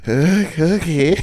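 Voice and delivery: high, put-on character voice; Cote. Impressionist doing classmate on request, high voice. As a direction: squeeze it up into a caricature. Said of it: "greatest one of them all".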